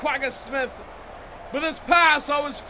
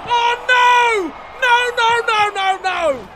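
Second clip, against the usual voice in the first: loud, higher cries of dismay; anguished shout; 9 semitones higher, 10 dB louder, slower than usual. Real, not acted. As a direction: howl as the chance slips away. The attack breaks down.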